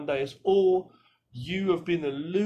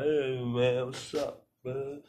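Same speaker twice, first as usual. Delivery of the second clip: put-on drunk mumble; 3 dB quieter, much slower than usual. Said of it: "who's out of it".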